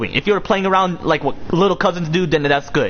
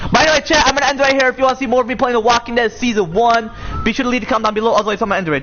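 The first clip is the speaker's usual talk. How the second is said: higher upbeat sign-off; streamer outro; 8 semitones higher, faster than usual. Acted. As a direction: wrap up the stream brightly.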